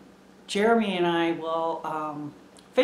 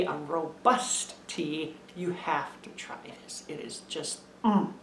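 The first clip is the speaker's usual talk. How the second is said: hushed savoring; 3 semitones lower, 7 dB quieter than usual. Real, not acted. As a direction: savor the tea under your breath. Savoring tea, voice drops away.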